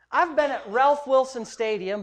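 ordinary talk, nothing marked

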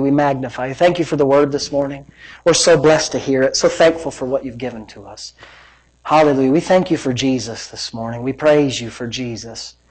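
low, soft pastoral prayer. Real, not acted. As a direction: pray quietly and reverently. Leads closing prayer.

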